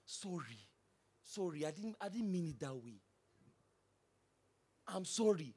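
a soft, humble apology to calm things down; gentle apology. Shown as a demonstration. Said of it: "Learn to say".

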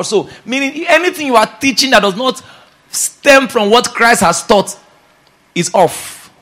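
ordinary talk, nothing marked

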